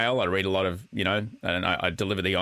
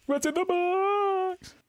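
very high, long drawn-out mock wail; Brad Pitt; much slower than usual. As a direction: stretch BOX out in a squealing movie-star parody. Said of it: "without going Brad Pitt".

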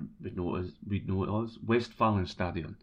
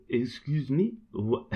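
lightly put-on French accent, a bit higher; 4 semitones higher, slower than usual. Woman near the Eiffel Tower asking the fans, in a light French accent. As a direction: play the puzzled French woman. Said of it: "try a French accent here".

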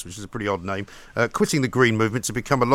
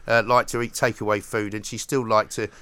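usual speaking voice

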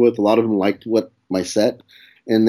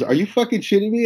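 retold manager in sharp, high disbelief; shocked disbelief; 11 semitones higher than usual. Manager stunned by newbie, high incredulous outburst. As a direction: pitch it way up, stunned.

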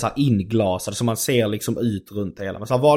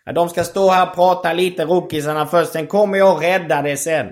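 high, raised announcing voice; mock grand proclamation; 7 semitones higher, 4 dB louder than usual. Comedian bursting in to reassure the audience, loud mock-saviour proclamation. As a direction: proclaim it like a self-appointed saviour. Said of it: "Sträcker ut händerna och skriker".

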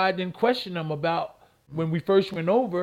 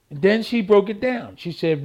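ordinary talk, nothing marked

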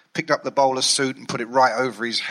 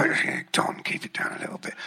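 whispered, hushing him; 8 dB quieter than usual. Retold or imitated. Hushing Don in the pub.

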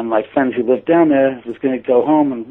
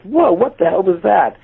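pitched high, a stunned retold shout of disbelief; startled exclamation; 8 semitones higher than usual. After the vision in the rearview mirror, a high startled exclamation. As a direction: blurt it like you can't believe your eyes.

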